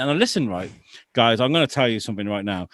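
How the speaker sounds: normal talking voice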